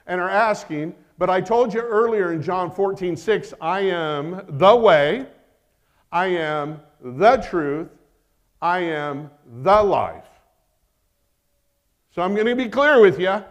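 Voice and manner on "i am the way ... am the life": each phrase slow, weighted and separated; slow, deliberate preaching of the quote; slow emphatic scripture. Acted. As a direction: lay out every I am with weight, slowly.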